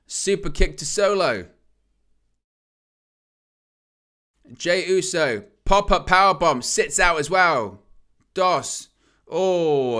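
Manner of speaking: live ringside play-by-play; wrestling commentator. Real, not acted. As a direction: call the near-falls, building hype. Live play-by-play of tag match.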